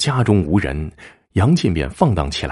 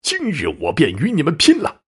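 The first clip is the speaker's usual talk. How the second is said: enraged, desperate. A narrator's reading. Husband confronts the men who attacked his wife, enraged. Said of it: "气急败坏地说".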